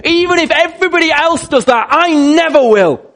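high, loud and fervent promise; impulsive vow. Acted. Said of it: "he just kind of responds impulsively".

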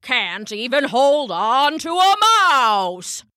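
loud, slow disgusted yell; narrated old man's angry yell; Papa yelling. A narrator's reading. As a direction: bellow it at the cat as Papa. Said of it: "Papa yelled".

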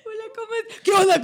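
yelling hello like in Morelos; mock Morelos shouting greeting; mock shouted greeting. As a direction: call out too loud. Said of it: "todos gritan".

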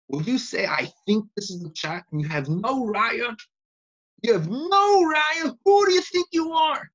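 high, loud, outraged shouting; screamed rebuke. Retold or imitated. Rabbi rebuking a student in the stairwell, screaming. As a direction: tear into him, voice climbing to a yell. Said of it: "he screamed at the top of his lungs".

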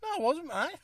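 high squeaky voice. Retold or imitated. Driver denies, high pitched.

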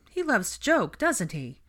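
high, cheery reassurance; bright. A narrator's reading. Ginny passes the attack off as joking, brightly. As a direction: make it sound like fun to a child. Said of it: "Ginny said brightly".

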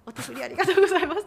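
loud, fast, high election car thank-you; campaign announcer shout. Retold or imitated. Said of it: "大きい声で".

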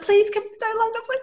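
put-on high pleading child voice; high, loud whiny child begging to stay longer; pleading kid. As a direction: beg like a little kid who wants to stay. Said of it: "I'm like".

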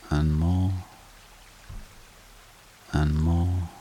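slow, calm meditation guide voice; guided meditation. Acted. Meditation host guiding listeners to relax, calm and unhurried. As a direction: ease the listener down into stillness.

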